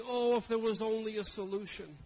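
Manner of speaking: loud, high mock lament; mock moping. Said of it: "we go around moping and saying".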